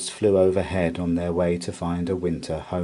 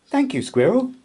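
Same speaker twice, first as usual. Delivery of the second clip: grateful mouse; 5 dB louder than usual. A narrator's reading. Milly thanks.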